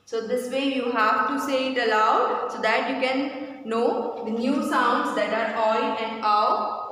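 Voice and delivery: ordinary talk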